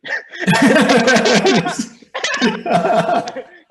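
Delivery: big burst of high laughter; loud laugh. Real, not acted. Telling a studio story, laughing loudly. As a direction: let out a long, loud real laugh.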